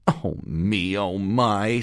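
sighing. A narrator's reading. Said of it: "sighed Ollie".

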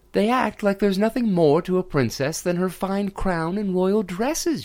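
high, sulky princess voice; a young princess grumbling to herself; frowning princess. Acted. Princess grumbles to herself about her suitors, high and sulky. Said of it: "she said to herself with a frown".

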